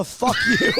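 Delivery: high shouted curse. Retold or imitated. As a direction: yell it high. Retold insult climax.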